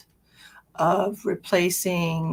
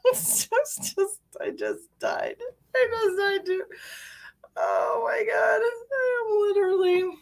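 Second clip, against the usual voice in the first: high voice breaking with laughter; can barely speak for laughing; laughing hard. Real, not acted. Dying of laughter.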